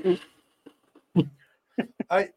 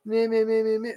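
mocking blather; 5 semitones higher than usual. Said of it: "people have tried so hard to be like".

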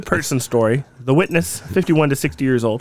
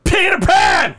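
exasperated shout; 13 semitones higher, slower than usual. Real, not acted. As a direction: shout it in disbelief.

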